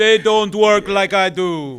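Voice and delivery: put-on loud, high, drawn-out Jamaican accent. Host imitates his Jamaican friend complaining, loud Jamaican accent. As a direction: belt it out slowly as a mock Jamaican. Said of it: "That was a great Jamaican accent".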